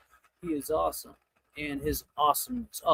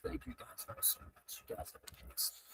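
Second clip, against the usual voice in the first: whispered thanks; 4 semitones higher, 19 dB quieter than usual. Real, not acted.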